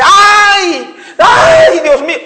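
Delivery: quejido agudo y gritado de parturienta; grito de agonía imitado. Retold or imitated. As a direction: imita a una mujer que grita como si se muriera. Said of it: "como que estuviera muriéndose". (grito agudo imitado, como de moribunda)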